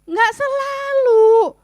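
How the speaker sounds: loud, high, drawn-out objection; hammed-up spousal protest; protesting retort. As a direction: push back indignantly, stretching it out. Acted retort to spouse's accusation.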